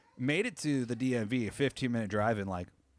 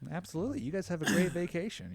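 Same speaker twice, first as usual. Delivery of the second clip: sweet clerk; 7 semitones higher, faster than usual. Retold or imitated.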